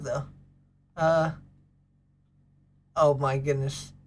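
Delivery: ordinary talk, nothing marked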